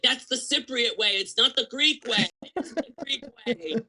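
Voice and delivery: high mock yell from the aunt; hammed-up aunt scolding her husband. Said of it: "she would yell at him".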